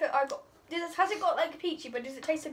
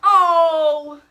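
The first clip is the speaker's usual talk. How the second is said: sudden loud oh, hamming up disgust; loud groan; 3 semitones higher, 15 dB louder than usual. Real, not acted. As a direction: blurt it out loud. Reacting to bad jelly bean.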